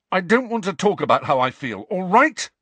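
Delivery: put-on furious teenager losing control; shaking with rage. A narrator's reading. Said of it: "shaking with rage".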